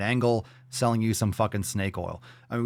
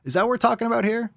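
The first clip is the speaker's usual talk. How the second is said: incredulous; 12 semitones higher, 3 dB louder, faster than usual. Real, not acted. Incredulous mock question.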